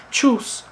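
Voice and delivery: "Tschüss" chirped high and short; southern farewell. Shown as a demonstration. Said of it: "the southern way of saying things".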